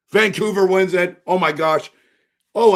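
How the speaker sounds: loud, high burst of joy; thrilled surprise. Real, not acted. Fan seeing his own team win the lottery, loud thrilled cheer. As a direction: cheer like your team just won.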